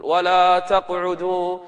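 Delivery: slow melodic tajweed chant; Quran recitation. Acted. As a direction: chant the verse, stretching the vowels.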